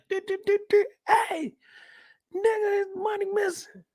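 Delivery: very high panicked wail on the phone; frantic caller. Pretend. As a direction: plead to the bank in a high cry. Panicked call to the bank.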